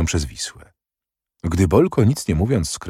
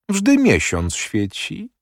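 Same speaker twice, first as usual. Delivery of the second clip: high, drawn-out mild mockery; understated sarcastic jibe; mocking; 8 semitones higher, much slower than usual. A narrator's reading. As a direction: taunt lightly, stretching it out high and slow. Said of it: "powiedział Bolko z kpiną".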